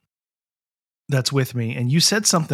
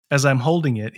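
normal talking voice

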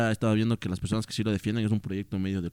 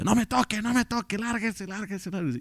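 much higher, strained mock shout; brother yelling at driver; 10 semitones higher, 4 dB quieter than usual. Teller imitates his grieving brother driving off the driver, high and strained. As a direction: bark him away, voice cracking high. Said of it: "el chaval le decía".

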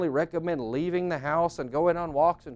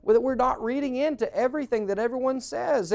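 ordinary talk

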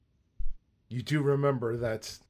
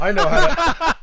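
higher, louder, cracking up; laughing through words; 13 dB louder than usual. Acted. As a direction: lose it mid-line. Guard line breaks into laughter.

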